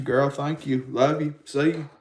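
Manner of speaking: ordinary talk, nothing marked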